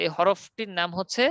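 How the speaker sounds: normal talking voice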